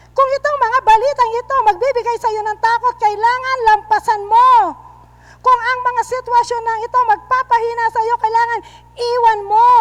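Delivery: loud, firm sermon push; forceful preaching. Acted. Pastor commanding believers to leave fear, forceful preaching. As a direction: drive each command home.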